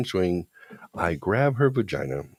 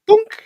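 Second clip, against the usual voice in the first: a sudden, much louder and higher "Boonk!" sound effect; mock impact noise; 13 dB louder than usual. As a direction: blurt out a cartoon-style bonk noise. Imitating the grab, sound effect.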